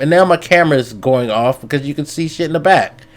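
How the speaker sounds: normal talking voice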